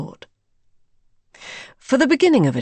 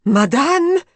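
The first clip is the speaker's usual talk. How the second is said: loud, high, rising call; alarmed cry; 6 semitones higher, 6 dB louder than usual. A narrator's reading. Maid Elise rushing in, crying out for her mistress.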